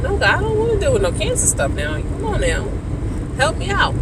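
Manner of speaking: high-pitched, exasperated plea; pleading with God. Retold or imitated. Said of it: "I'm talking to God like".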